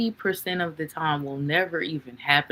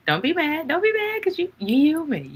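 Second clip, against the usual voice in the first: much higher, teasing "don't be mad"; playful high-pitched reassurance; 11 semitones higher, 4 dB louder than usual. Acted. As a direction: coax them lightly in a high squeaky voice.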